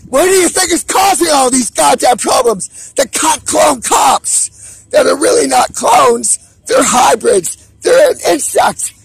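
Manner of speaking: loud, high, agitated yelling; ranting shout. Real, not acted. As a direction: shout it like a desperate warning. Ranting loudly about conspiracy.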